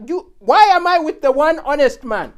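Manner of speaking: loud, high nagging wife; hammed-up furious wife voice; exasperated wife. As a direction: berate him for turning down the money. Wife scolding her honest husband.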